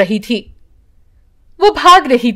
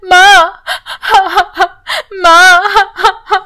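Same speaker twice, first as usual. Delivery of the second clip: put-on crying children; 5 semitones higher, much slower than usual. A narrator's reading. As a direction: wail for mother in tears. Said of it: "रोने लगती है".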